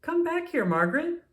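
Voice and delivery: high, loud call after his little sister. A narrator's reading. Daniel calls giggling Margaret back across the backyard, high loud call. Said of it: "Daniel called out".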